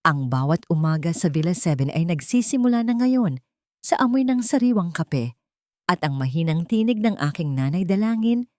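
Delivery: plain reading voice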